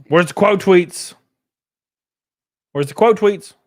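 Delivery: high, loud, insistent call; loud demanding question. Real, not acted. Streamer hunting for quote tweets, loudly insistent.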